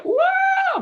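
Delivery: high, sustained mock shriek; imitated possessed scream. Speaker imitating a possessed student at school, high mock scream. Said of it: "Biasanya kan teriak".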